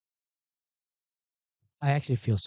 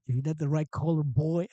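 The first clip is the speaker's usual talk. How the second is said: mock Southern accent; 6 semitones higher, 5 dB louder than usual. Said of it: "Am I using the proper accent right now".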